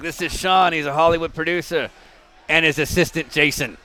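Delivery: put-on South Boston accent. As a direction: imitate a friend's thick South Boston accent. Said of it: "thick South Boston accent".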